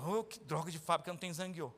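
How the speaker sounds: low, soft whiny grumble; grumbling worker. Retold or imitated. Preacher mocks a worker complaining about the factory, low whiny grumble. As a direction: moan like a never-satisfied worker.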